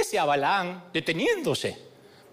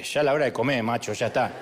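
talking dog; 9 semitones lower, faster than usual. Pretend. The pet dog tells its owner it's dinnertime, lightly voiced and low. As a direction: play the house dog asking for dinner, low and casual. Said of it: "Viene el perro y te dice".

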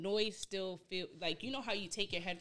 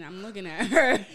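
ordinary talk, nothing marked

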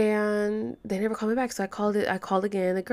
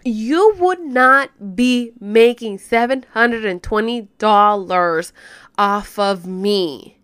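real indignation; 7 dB louder than usual. Real, not acted. New mother venting about the company's charges, indignant. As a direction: lay into them loudly, hammering each word.

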